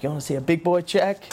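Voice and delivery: light Southern quarterback voice, high; teasing in a Southern accent. Retold or imitated. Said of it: "He's like".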